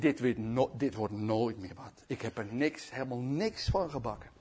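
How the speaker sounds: low, soft defeated voice; acted dejection; despairing self-reproach. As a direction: give up on yourself quietly. Peter despairs after weeping, acted.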